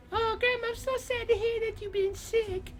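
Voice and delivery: put-on high, sympathetic little-girl falsetto. Little Red consoling sick Grandma, high girlish voice. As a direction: pitch way up, sorry for poor Grandma.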